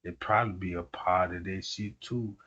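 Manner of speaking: muttering to himself at the chat; muttered aside. Retold or imitated. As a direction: grumble it under your breath. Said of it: "I'm like".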